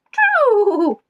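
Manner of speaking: high, loud announced true; dramatic reveal. Acted. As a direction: ring it out like a game show reveal. Revealing the quiz answer.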